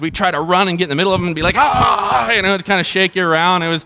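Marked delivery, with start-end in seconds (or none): 1.6-2.3 high mock yell; long playful "aah", pitched up; re-enacted scream of kids in a whirlwind; imitated excited scream. Acting out kids inside dust devil.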